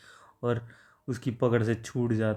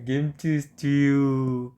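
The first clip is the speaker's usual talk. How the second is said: drawn out, slightly raised yell of the dish name; 3 semitones higher, 3 dB louder, much slower than usual. A narrator's reading. MC yelling at the beaten brother, drawn-out shout. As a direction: stretch it into a mock roar. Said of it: "ज़ोर से चिल्लाता है".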